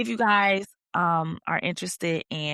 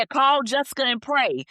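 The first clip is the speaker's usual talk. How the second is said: high, emphatic quoted order; exasperated insistence; 7 semitones higher, 4 dB louder than usual. Acted. Host quoting God's simple instruction to overdoers, exasperated. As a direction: insist on it, pitch lifted.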